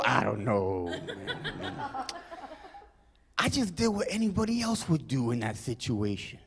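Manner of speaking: soft, humble-hero murmur; false modesty. Acted. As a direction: play the reluctant hero very quietly.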